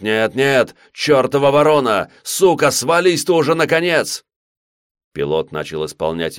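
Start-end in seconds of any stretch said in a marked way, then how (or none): 0.0-4.2 rising to a high frantic yell; desperate scream. Acted. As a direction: scream at the crow in terror. Grenade on windshield.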